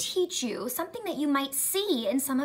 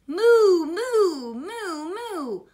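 cow mooing; 6 semitones higher, 5 dB louder, much slower than usual. Acted. Teacher voicing the cow for children, long swooping moos. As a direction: moo like a storybook cow. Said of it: "said the cow".

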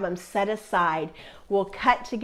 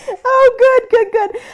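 much higher and louder, thrilled; delighted; 14 semitones higher, 10 dB louder than usual. Real, not acted. Teacher thrilled by viewers' hearts, delighted. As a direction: burst out with real joy.

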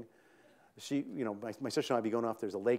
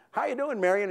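cheerful greeting; 9 semitones higher, 4 dB louder than usual. Retold or imitated. Butcher greeting mom.

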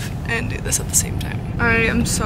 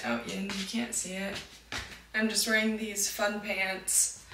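usual speaking voice

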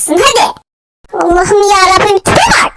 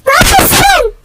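startled shout; 9 semitones higher than usual. Acted. Baby suddenly does something.

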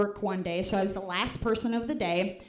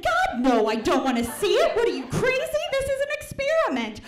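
higher and louder, a rising panicked yell; horrified yell; 13 semitones higher, 6 dB louder than usual. Retold or imitated. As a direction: refuse in loud mock horror, climbing up. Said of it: "And then I said".

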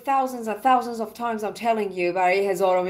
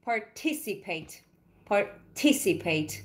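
Participate broken after "Par", then stressed on "ti"; slow Participate, pause before a lifted "ti"; stress on second syllable; 6 dB quieter, much slower than usual. Shown as a demonstration. Teacher showing students where the voice goes up, stress on the "ti" of Participate. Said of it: "رو ت میری بالا".

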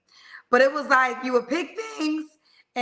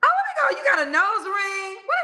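high, loud delighted gasp; excited squeal; 7 semitones higher, 3 dB louder than usual. Real, not acted. Host spotting guest's nose ring, squealing with delight. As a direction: gush over the new look.

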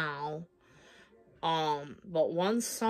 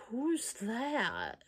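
higher, softer baffled question; retold puzzlement; 5 semitones higher, 4 dB quieter than usual. Woman reacting to an unknown number, puzzled. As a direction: act out not knowing the sender.

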